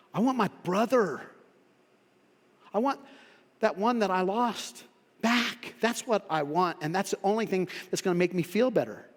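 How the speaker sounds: normal talking voice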